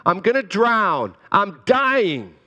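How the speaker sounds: high, loud panicked plea; desperate cry. Retold or imitated. Pastor voicing David crying out to God, high and desperate. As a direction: cry out like you're drowning. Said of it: "David says".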